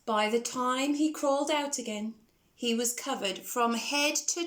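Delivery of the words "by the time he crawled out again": usual speaking voice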